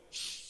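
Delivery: short sharp hush; shush. Acted. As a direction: imitate a child shushing a parent. Plays child shushing dad.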